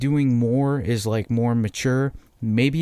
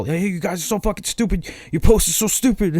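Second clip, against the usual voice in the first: high, sneering put-on voice, louder at the end; mocking rant; 9 semitones higher, 3 dB louder than usual. Posting a rant telling everyone their posts are stupid, high sneering mock voice. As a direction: jeer at them like a know-it-all troll.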